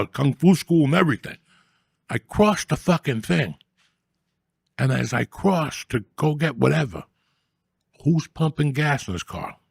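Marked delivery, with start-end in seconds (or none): none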